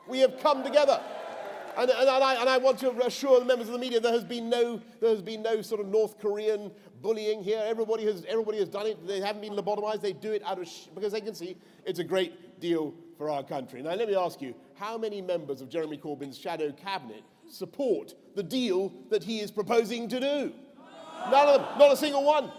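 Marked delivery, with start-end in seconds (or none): 0.1-5.6 rallying shout. Real, not acted. Rousing rally crowd. 21.3-22.5 rally shout. Real, not acted. Rally rebuttal.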